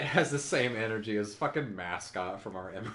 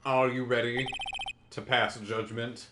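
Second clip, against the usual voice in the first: deep Godot voice; 6 semitones lower than usual. Acted. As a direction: challenge the judge coolly. Godot challenging the judge.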